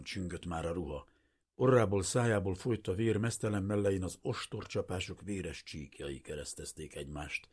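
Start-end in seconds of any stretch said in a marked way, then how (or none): none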